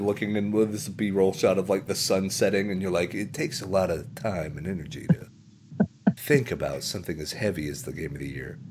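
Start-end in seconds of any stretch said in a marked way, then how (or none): 3.1-8.6 softer, lower, lightly ponderous narration; mock solemn voiceover. Podcaster parodying a reflective game video, mock solemn. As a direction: muse with mock gravity, easing down.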